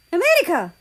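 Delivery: loud high call of a lost lover; loud overjoyed cry; yelling a name. Acted. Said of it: "he yelled".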